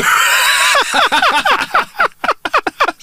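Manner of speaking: high-pitched burst of laughing; real laughter. Real, not acted. Joke teller cracking up at the punchline, real high laughter.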